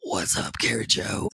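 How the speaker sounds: a mock demon peeking round the bedroom door, low and whispery; little demon voice. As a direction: play a sly little demon teasing her. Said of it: "a little demon peeking around the corner being like".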